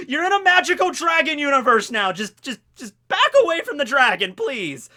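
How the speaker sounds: high, loud acted plea; frantic pleading. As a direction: urgently beg her to back off, half laughing. Retold character pleading with Macy.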